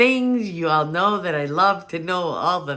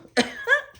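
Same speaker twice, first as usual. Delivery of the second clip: high giggle. Real, not acted. Laughs at getting emotional.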